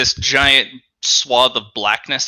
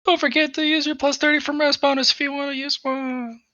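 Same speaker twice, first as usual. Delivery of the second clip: high-pitched reminder; 11 semitones higher, 4 dB quieter than usual. Acted.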